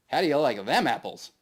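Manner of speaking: swaggering yell after a blast; gloating shout. Acted. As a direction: crow it like you've just won. Triumphant taunt as light flares.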